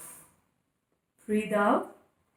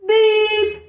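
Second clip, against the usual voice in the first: high, loud robotic beeps; loud playful beeping; energetic beep; 8 semitones higher, 7 dB louder than usual. Shown as a demonstration. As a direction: shout it to wake the kids up. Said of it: "Can I hear you again?".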